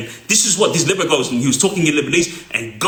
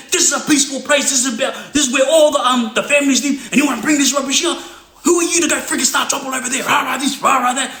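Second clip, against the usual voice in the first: high, fast angry tirade; imitated heated lecture, fast in places; going off; 7 semitones higher, faster than usual. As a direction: rant at him, pitch way up. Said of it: "He's going off his head".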